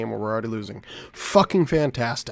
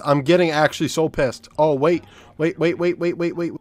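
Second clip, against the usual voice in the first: normal talking voice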